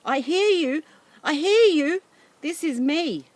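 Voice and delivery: shouting back, frantic to be heard; boy yelling into the radio; desperate relief. Acted. Boy finally gets an answer.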